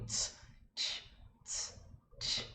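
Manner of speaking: "Tss" hissed at the teeth, "tch" opened out; ts against tch. Shown as a demonstration. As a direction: whisper "Tss" short, then the wider "tch". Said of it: "ts sai na frente do dente bem curtinho". ("Tss" curto no dente, "tch" aberto)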